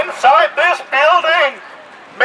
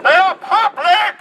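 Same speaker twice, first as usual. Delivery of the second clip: usual speaking voice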